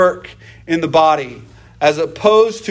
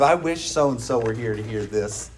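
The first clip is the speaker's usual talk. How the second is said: lower, softer, self-satisfied mutter; smug busybody; 7 semitones lower, 7 dB quieter than usual. Retold or imitated. Preacher mimics a churchgoer who thinks the message is for others, low smug mutter. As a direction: say it as if the sermon is for someone else. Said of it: "That's the worst attitude to have".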